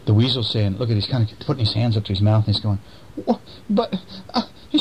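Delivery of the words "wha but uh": high squeaky startled weasel; high flustered weasel stammer. Acted. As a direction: play the flustered weasel, pitched way up. Weasel voiced in read story.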